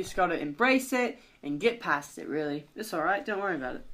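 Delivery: casual British pep talk, easing off; British accent. Acted. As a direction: reassure like a laid-back Brit. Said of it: "Mallard and Shallard will have British accents".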